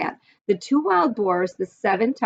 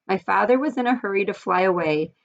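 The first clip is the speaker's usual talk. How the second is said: usual speaking voice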